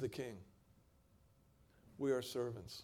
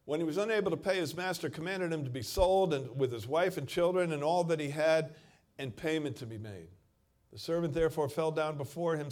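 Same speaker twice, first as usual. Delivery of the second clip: loud, raised scripture reading; forceful preaching; 4 semitones higher, 7 dB louder than usual. Acted. Preacher narrates the master's command to the church, loud. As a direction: build the drama of the sale.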